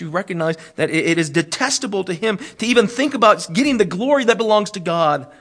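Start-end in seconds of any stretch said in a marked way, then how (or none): none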